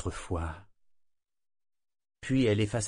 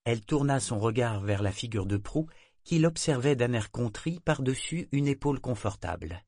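ordinary delivery

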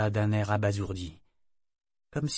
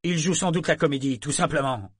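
curt and clipped, higher and louder; brusque; 5 semitones higher, 5 dB louder than usual. Acted. Captain cutting off his mate about his son, brusque. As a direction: snap it off to end the matter. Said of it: "d'un ton brusque".

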